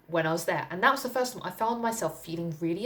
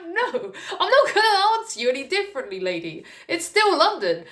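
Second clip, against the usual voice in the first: high, louder, laughing refusal; laughing defiance; 11 semitones higher, 6 dB louder than usual. Retold or imitated. Answering the prying lady, high laughing refusal. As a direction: refuse her through laughter. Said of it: "I was like".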